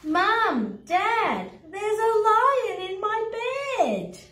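high, drawn-out call for the parents; acted cry for help; alarmed child calling. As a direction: call out like an alarmed little kid. Child calls parents about a lion.

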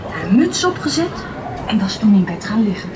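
ordinary delivery

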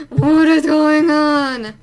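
loud, drawn-out exasperated cry; loud and stretched with frustration; exasperated outburst. Real, not acted. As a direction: belt it out slowly in disbelief. Exasperated at failing game.

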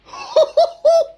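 high burst of delighted laughter; excited laugh. Real, not acted. Fixer laughing as the board comes alive, delighted laugh. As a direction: laugh out in sheer relief and joy. Motherboard finally boots, celebrating.

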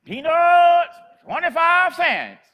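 very loud, high, slow street-vendor cry; drawn-out hawker's shout; vendor's call. Retold or imitated. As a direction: belt it out like an old peanut seller down the block. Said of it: "she called out".

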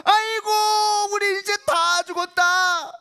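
high, slow grief-stricken cry; wailing lament. Acted. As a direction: wail that all is lost. People wail all night. (통곡하듯 천천히)

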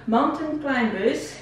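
Mountain Climbers said slowly in English. Shown as a demonstration. Teacher modelling the name slowly for the class. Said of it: "repete comigo".

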